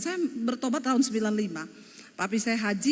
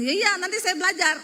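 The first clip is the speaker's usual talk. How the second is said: exasperated agreement; 6 semitones higher, 3 dB louder than usual. Retold or imitated. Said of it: "Saya bilang".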